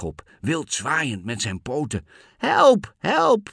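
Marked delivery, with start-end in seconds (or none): 2.4-3.5 very high, loud cry for help; shouting for help. A narrator's reading. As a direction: scream for rescue as the alarmed cat. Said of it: "schreeuwde hij". (luid en hoog om hulp schreeuwen)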